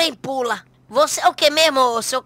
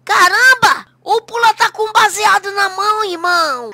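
loud, high astonished outburst; shocked shout; 7 semitones higher, 6 dB louder than usual. Acted. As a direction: blurt it out in shock. Catches cop holding a joint.

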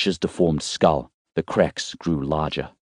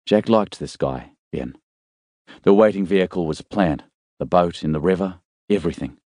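ordinary delivery, nothing marked

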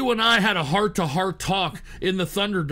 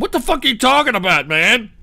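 ordinary delivery

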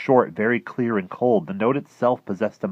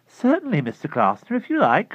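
much higher, soothing a madman; humoring lady; 12 semitones higher, 6 dB louder than usual. Acted. Mrs Saunders humoring her neighbor, overly sweet.

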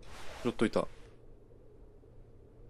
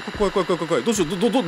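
scared; 6 semitones higher, 3 dB louder, much faster than usual. Real, not acted.

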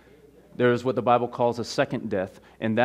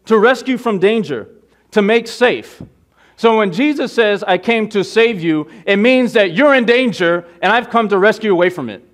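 loud, raised-pitch urgent sermon; forceful preaching; 7 semitones higher, 10 dB louder than usual. Real, not acted. Preacher warning the congregation they are in danger, forceful preaching. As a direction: drive each phrase home to the congregation.

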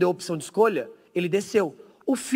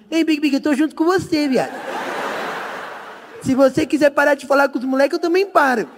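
higher-pitched imitation of a buddy; lightly voiced friend; 6 semitones higher than usual. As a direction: pledge loyalty in a light mock buddy voice. Imita o Tigas falando. (voz de amigo imitado, mais aguda)

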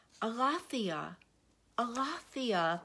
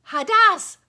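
"Hadas" shouted much higher and louder; emphatic shout; 11 semitones higher, 19 dB louder than usual. Real, not acted. Teacher shouts the Greek word to drive it home. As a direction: belt "Hadas" out with gusto.

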